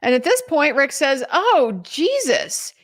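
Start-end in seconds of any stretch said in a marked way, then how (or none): 1.3-2.7 higher, drawn-out exasperated quote; retold frustration. Retelling the suspect seeing the detectives' game, frustrated. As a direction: imitate someone fed up, stretching the words slowly. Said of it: "He's getting frustrated".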